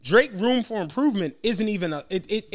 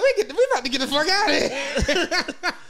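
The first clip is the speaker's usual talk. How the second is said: laughing quote; 7 semitones higher than usual. Retold or imitated. Retold exit line, laughing.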